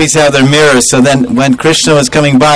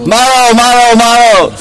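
high, raised war cry of kill, kill; mock battle yell; 8 semitones higher than usual. Gopis ambushing the cowherd boys, high rowdy yell. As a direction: chant the maro like a charging mob.

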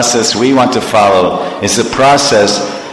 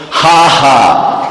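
mock guru laughter; 5 semitones higher than usual. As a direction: laugh out the grand secret teaching. Guru reveals secret syllables.

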